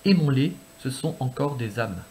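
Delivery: slow, low poetry reading; measured, hushed delivery of verse; poem recitation. Acted.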